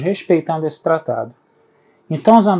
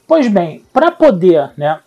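ordinary talk

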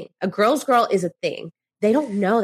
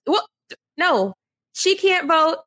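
ordinary delivery

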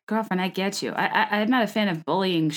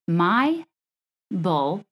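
MY, BULL, FINE each set apart with pauses; word by word; much slower than usual. Shown as a demonstration. Host teaching an accent trick, My bull fine word by word. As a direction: spell out My, bull, fine one word at a time. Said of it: "if you say".

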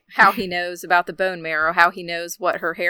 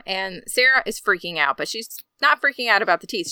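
ordinary talk

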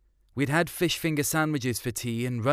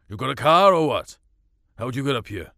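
louder blunt farmer's question; gruff farmer dad; 6 dB louder, faster than usual. Acted. Farmer dad quizzing the girls, louder and blunt. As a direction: bark it like a suspicious farmer. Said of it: "Dad asked".

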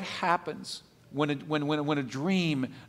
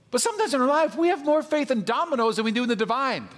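high, loud and punchy sermon delivery; impassioned preacher building to a punchline; fired-up preaching; 10 semitones higher, 5 dB louder than usual. Acted. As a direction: preach it emphatically, pitched well up and driving the contrast home. Preaches at full force.

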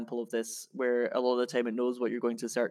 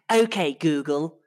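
put-on English accent, slow and louder; fake English accent; 3 semitones higher, 7 dB louder, slower than usual. Shown as a demonstration. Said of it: "put on, like, a fake English accent".

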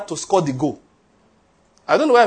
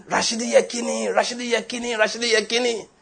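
high, softened imitation of a shout; mock shouting; 4 semitones higher, 5 dB quieter than usual. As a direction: chant the name like a distant celebrating shout. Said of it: "he was shouting".

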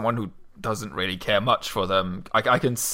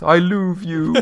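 put-on higher, louder "I louvre you" with a vague foreign accent; mock non-native accent; 7 semitones higher, 6 dB louder, slower than usual. Co-host imagines a second-language English speaker, a put-on foreign accent. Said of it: "second language speaker of English".

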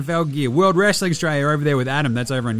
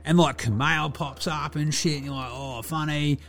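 higher-pitched ocker larrikin, casually enthusiastic; Delly, laid-back Aussie mate; 6 semitones higher than usual. Acted. Player checking in with fans to review a show, laid-back ocker mate. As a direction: play a chilled, sweary bloke reviewing telly.

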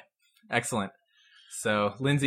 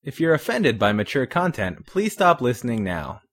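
projected, louder announcer delivery; podcast disclaimer read; 6 dB louder than usual. Acted. Host warns listeners before the show, announcer read. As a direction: deliver a formal content warning.

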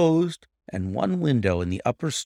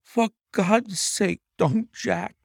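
high, slow desperate plea to her husband; high pleading wife's cry; 9 semitones higher, slower than usual. A narrator's reading. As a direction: beg him to stop, slowly. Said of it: "she cried".

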